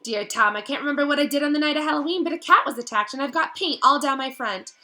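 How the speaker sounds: high, loud and rushed panic; a frightened girl losing her grip; desperate diary pleas building to a cry of Tom; frantic Ginny. Acted.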